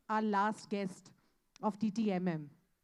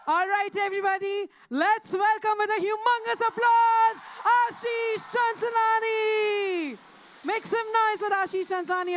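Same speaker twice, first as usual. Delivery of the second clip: stage announcer hype; 7 semitones higher than usual. Acted. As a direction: bring the guest on with full energy. Host announcing guest, hyping crowd. (मंच पर जोशीली घोषणा)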